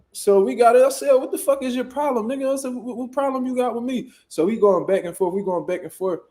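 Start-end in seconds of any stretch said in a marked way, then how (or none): 1.1-4.1 high, heated demand; pitched-up angry confrontation; retold argument. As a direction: press him hard on his problem. Arguing with the older man.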